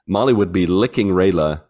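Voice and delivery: ordinary delivery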